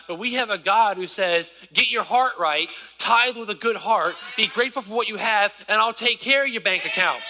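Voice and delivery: full-force preacher; preaching. Acted. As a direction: preach it out to the congregation. Preaching with God voiced.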